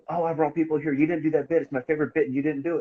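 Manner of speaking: mock-complain like a let-down regular; whiny fan complaint. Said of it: "And then you have people go".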